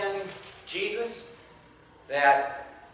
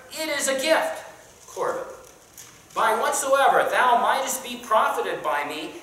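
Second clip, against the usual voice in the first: higher, louder preaching read; King James read with preacher's lift; scripture reading; 4 semitones higher, 4 dB louder than usual. Acted. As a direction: declaim the verse to the class. Reads scripture aloud to class.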